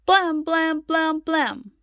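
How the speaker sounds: loud high banging syllables; knocking sound. Acted. Mother imitating loud knocking on the door. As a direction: bang out the knocks.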